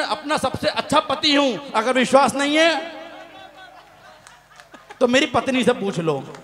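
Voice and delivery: usual speaking voice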